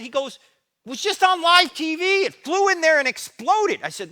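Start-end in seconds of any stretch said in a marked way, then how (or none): 0.9-3.8 much higher, shouted frantic report. Retold or imitated. Pastor shouting what he saw on live TV, frantic. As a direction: blurt it out, shocked and shouting.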